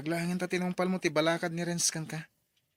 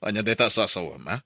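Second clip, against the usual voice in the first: irritated; 5 semitones lower, 8 dB louder than usual. Acted. Husband snaps back at wife.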